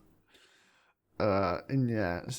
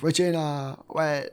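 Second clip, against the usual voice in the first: raised, higher call to someone leaving; calling after someone; 6 semitones higher, 4 dB louder than usual. Retold or imitated. Katie chases Regina out, calling after her. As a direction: call out to stop her walking away.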